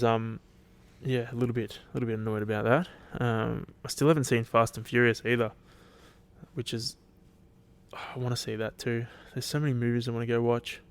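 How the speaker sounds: ordinary delivery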